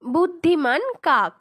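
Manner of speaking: usual speaking voice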